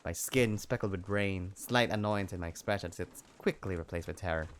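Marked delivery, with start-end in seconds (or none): none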